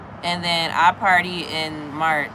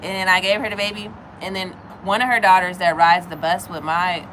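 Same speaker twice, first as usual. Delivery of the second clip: ordinary talk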